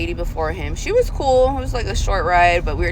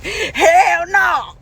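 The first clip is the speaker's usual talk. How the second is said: loud, high, laughing "hell no"; laughing retort; 11 semitones higher, 5 dB louder than usual. Retold or imitated. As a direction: laugh it off like the rider did. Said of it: "And he starts laughing".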